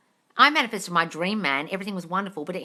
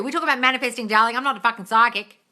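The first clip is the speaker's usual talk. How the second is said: usual speaking voice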